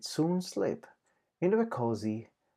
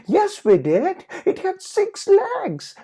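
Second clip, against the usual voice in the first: very high, loud, slow insistence; child yelling; 8 dB louder than usual. Acted. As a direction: shout to be believed. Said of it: "Patty yelled".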